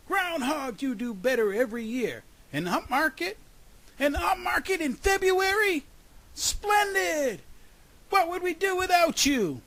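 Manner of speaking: very high, loud, building exclamations; delighted roar. A narrator's reading. Kramer waking to the groundhog's news, delighted roar. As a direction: roar praise with overjoyed amazement. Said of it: "he roared in delight".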